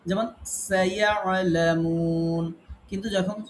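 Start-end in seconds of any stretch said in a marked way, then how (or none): 0.7-2.4 "সায়ালামুন" drawn out very slowly with a thin, light s; thin sin recitation. Shown as a demonstration. Teacher demonstrating the thin sin to students, slow recitation. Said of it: "সিনটাকে আমরা বারিক পড়ি".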